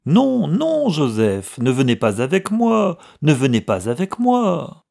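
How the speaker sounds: loud, high-pitched, insistent protest; frantic plea. A narrator's reading. Clerk stopping his brother boarding the train, frantic plea. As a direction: beg him desperately to stay off. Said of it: "s'écria Samson en voyant son frère entrer".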